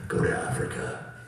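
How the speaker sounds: very slow, mock-solemn deep rumble; mysterious inner voice. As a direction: drag it out like a booming voice from beyond. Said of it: "I'd hear this voice inside me".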